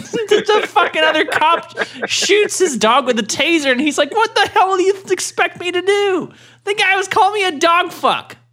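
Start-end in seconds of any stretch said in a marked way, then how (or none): 4.1-8.4 much higher, hammed-up defensive whine; mock cop excuse. Cop defending tasing the dog.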